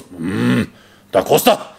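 low growled hum, then punched name; booming master's voice. Retold or imitated. Master summoning a student at dinner, booming call. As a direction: boom it out across a silent table. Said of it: "he goes".

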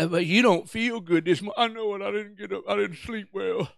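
soft, low mopey voice; quiet, defeated mumble; weary agreeing. Retold or imitated. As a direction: mope along with the devil's lie. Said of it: "the devil comes along".

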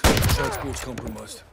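loud urgent report. Acted. Captain reporting over gunfire, loud and urgent. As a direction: drive the warning home. Radio alert in firefight.

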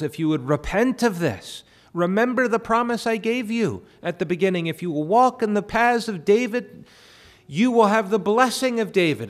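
loud, high, impassioned divine appeal; the Lord calling a king back, thundering from the pulpit; the Lord pleading. Acted. Preacher voicing God, urging the king to return at full force. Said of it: "as if the Lord is saying to Jeroboam".

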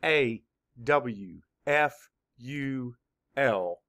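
A W F U L spelled out letter by letter, slowly; teacher spelling A W F U L for children. Shown as a demonstration. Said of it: "Spell it".

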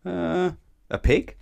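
slow, high, hesitant answer; unsure guess. A narrator's reading. Mister Dizzy stumped by the elephant's riddle, hesitant and high.

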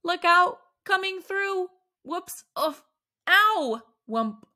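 a comic scramble of cries and thuds; crashing about in the dark. Acted. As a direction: stumble and bump into everything. Stumbling through dark room.

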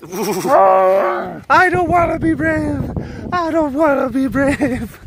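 high, slow whine for the cat; cat's whiny reply. Pretend. Answering for the scared cat in a high whine. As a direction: whine it like a reluctant kitten. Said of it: "voicing the cat's reply".

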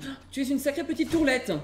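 high, mincing Parisian voice; mock a snobby Parisian kid; high affected city-boy voice; posh Parisian imitation. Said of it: "petit parisien qui vient et qui te dit".

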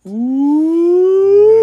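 loud, high ooh of surprise; impressed ooh. Real, not acted. Cohost reacting to the burn, loud high ooh.

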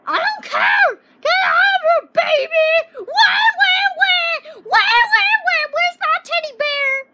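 high, loud, whiny shouting; bratty yelling. Acted. Kid yells at his dad to get out, high and loud. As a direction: scream back like a spoiled kid.

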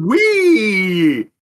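high, drawn-out wheee; gleeful whee. Real, not acted. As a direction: let out a long joyful squeal. Player cheers a lucky dice roll.

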